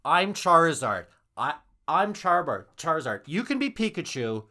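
loud, high playground bragging; acted second-grader. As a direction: claim the best part like a kid at recess. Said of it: "when you're in grade two pretending to play".

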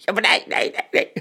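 hammed-up despairing bellow through a door; muffled roaring. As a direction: wail it out like a critic locked in a bin room. Same locked-in critic voice.

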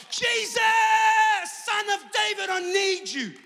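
high, long-held cry for help; desperate shout. Acted. Blind beggar begging Jesus for attention, shouting. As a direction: shout it out to be heard over everyone. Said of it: "he began to shout".

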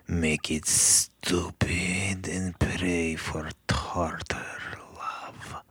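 breathy whisper, no voice. Acted. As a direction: whisper it into the machine. Odd voicemail message, whispered ending.

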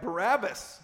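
loud, high shout back; crowd's shout. A narrator's reading. Crowd answering the governor's choice, shouting. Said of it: "The crowd shouted back".

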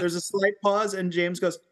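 ordinary talk, nothing marked

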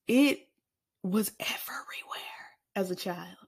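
hushed unvoiced whisper. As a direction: whisper it dramatically. Whispered aside.

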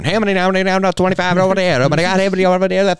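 fast, high auctioneer chant. Pretend. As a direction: rattle off bids like an auctioneer. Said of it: "like, an auctioneer going".

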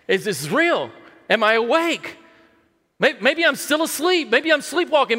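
amazed disbelief. Acted. Preacher voicing Hezekiah waking to deliverance, amazed disbelief. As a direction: voice climbing high in wonder.

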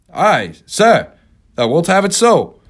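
first mate. Acted. Starbuck voiced in novel.

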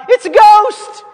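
high, loud frightened shout; terrified cry. Acted. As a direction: scream it out in panic. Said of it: "they cried out".

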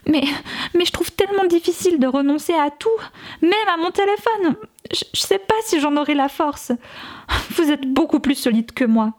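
voix aiguë et geignarde, en partie chuchotée; pleurnicher, presque en soufflant; pleurnicherie. A narrator's reading. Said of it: "pleurnichait une jeune femme". (jeune femme rousse qui geint devant le groupe, pleurnicharde)

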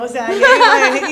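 bursting into loud laughter; laughing. Real, not acted. Host cracking up at her own line, laughing hard. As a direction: laugh it out, big and high.

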